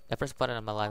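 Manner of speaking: ordinary talk, nothing marked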